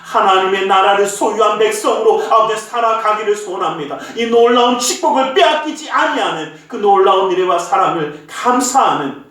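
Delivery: earnest, measured prayer voice; closing prayer. Acted. Pastor leads the congregation in a closing prayer, earnest. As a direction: pray over the people sincerely.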